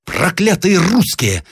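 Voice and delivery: high, drawn-out curse; general's high-pitched exasperation; cursing cry. A narrator's reading. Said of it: "закричал генерал".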